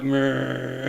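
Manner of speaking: drawn-out creature "meh"; imitated brain slug. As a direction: make a long, droning slug noise. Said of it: "It's just like".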